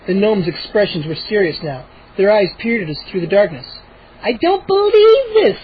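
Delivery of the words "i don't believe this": put-on high, slow, outraged girl voice; indignant cry. Mindy crying out at the gnomes. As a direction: refuse to believe it. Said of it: "Mindy cried".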